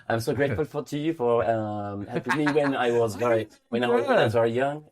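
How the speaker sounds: English spoken with a French accent. Shown as a demonstration. Friend performing on request, thanking someone in French-accented English. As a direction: put on a halting French accent. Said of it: "just the, the French accent".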